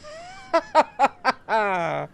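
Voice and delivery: big high scornful belly laugh; mocking laugh. Half elf laughing at the young challenger, loud and scornful. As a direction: laugh the kid off like a bully. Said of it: "starts laughing".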